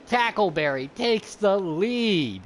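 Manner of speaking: loud, drawn-out lead-change call; race announcer's call. Real, not acted. Announcer calling Tackleberry into the lead, excited race call. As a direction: stretch it out, high and loud.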